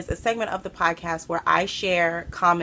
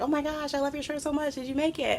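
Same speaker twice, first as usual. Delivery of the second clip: excited; 9 semitones higher, 6 dB quieter, faster than usual. Retold or imitated. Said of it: "they were so excited about my shirt".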